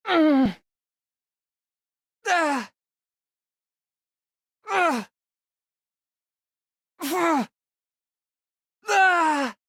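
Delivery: high pained cries as he's struck; hurt gasps from an injured fighter. Acted. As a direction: yelp as if taking a blow. Taking hits in combat.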